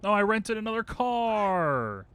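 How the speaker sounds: high, loud cry of frustration; dismayed wail. Real, not acted. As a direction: wail it, stretching the last word. Dismayed at renting wrong car.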